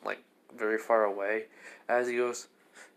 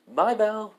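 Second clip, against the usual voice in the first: bright, higher, louder farewell; cheerful goodbye; 10 semitones higher, 6 dB louder than usual. Acted. Goddess calls goodbye as they leave, cheerful higher call. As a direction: call it after them happily.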